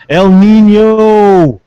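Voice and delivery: slow, stretched, high and loud announcer cry; mock dramatic El Nino. Host hamming up the weather joke, slow stretched cry. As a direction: belt it out like a weather-show bit.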